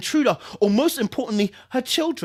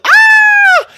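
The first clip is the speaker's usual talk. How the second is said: high, loud shriek of terror; piercing scream; 17 dB louder than usual. Acted. Narrator voicing the scream from the attic. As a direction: let out a horror scream.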